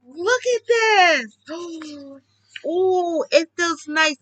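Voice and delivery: slow, high, awed look. Real, not acted. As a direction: marvel at it, drawn out. Excited unboxing vinyl.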